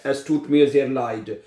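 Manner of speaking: very slow, each word spaced out, leid said as "lite"; slow careful German. Shown as a demonstration. Teacher modelling a German sentence for Urdu speakers, very slowly. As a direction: model Es tut mir sehr leid for learners, leid as "lite". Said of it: "इसको आपने लीड नहीं पढ़ना".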